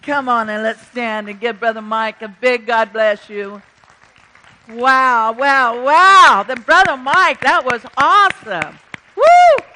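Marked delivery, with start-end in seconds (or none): 4.7-8.8 loud, swelling amazement; excited praise. Real, not acted. Pastor cheering Brother Mike's message, excited and loud. 9.2-9.6 high, loud "woo"; excited whoop. Real, not acted. Pastor whooping after a great message, excited.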